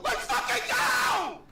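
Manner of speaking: slow, loud victory yell; triumphant shout. Retold or imitated. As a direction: belt it out like a win. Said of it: "Then I yell".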